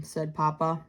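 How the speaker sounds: ordinary delivery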